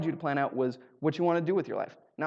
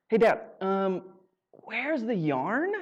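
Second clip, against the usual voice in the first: put-on slow, stretched kid asking; drawn-out ask; 5 semitones higher, much slower than usual. Kid slowly asking dad for the yarn, stretched out. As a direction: drag out each word innocently.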